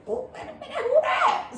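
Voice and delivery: high-pitched surprised squeal; double take. Retold or imitated. First sight of a woman at the party, high excited double take. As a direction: go up high, taken by sudden interest. Mock reaction to spotting her.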